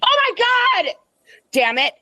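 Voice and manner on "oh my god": jolted by something at the door; startled. Real, not acted. Sudden shock at the door.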